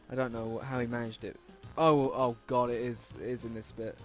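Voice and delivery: normal talking voice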